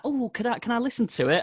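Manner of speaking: high, lightly mocking eager question; mock eager asker. Outsiders ask to hear the band, high mock voice. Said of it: "And they're like".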